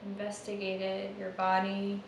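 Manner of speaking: soft, unhurried meditation guide; hushed meditation voice trailing into a whisper; guided meditation. Acted. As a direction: ease them into noticing the breath, gently.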